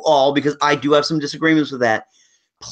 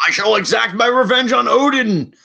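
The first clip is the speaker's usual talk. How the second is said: pompous villain; 8 semitones higher than usual. Retold or imitated. Mocking the villain's lines.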